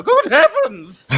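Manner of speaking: very high, slow, hammed-up posh cry; posh exclamation. To a police officer, a laughing mock posh exclamation. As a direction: gasp it like a shocked aristocrat. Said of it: "I get posher".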